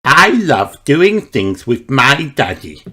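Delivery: Peppa voice. A narrator's reading.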